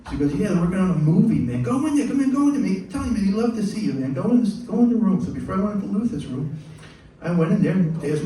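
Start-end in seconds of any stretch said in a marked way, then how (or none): 0.3-5.2 higher, louder imitation of an excited insider; eager urging. Road manager sends keyboardist next door, excited urging. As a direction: hustle him in, pitched up and loud.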